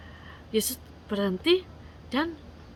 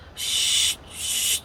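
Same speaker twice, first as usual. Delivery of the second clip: long, voiceless "shh"; crowd's sharp shushing noise. A narrator's reading. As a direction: hush someone who is yelling. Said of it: "orang-orang yang di sekitar situ".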